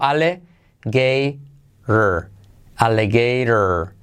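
"alligator" broken slowly into "ale - gei - rr"; slow syllable demo. Shown as a demonstration. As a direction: draw out "alligator" piece by piece for learners. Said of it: "Fíjate la transcripción que te pongo abajo". ("alligator" lento, por sílabas)

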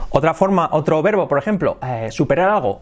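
ordinary delivery

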